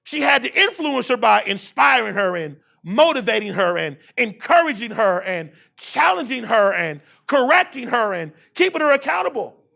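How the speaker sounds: usual speaking voice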